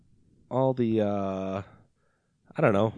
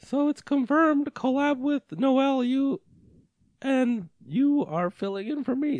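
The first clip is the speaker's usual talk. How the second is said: high, slow put-on voice of the host; Shane voice; slower than usual. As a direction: read it as Shane, pitched way up. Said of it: "Is that a good Shane voice?".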